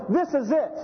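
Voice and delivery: high, thrilled declaration; hammed-up delight; excited exclaim. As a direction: announce you've found the one. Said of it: "he's going".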